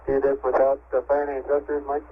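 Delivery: crackly radio transmission; over walkie talkie. Real, not acted. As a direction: voice coming through a radio link. Astronaut radio transmission from spacecraft.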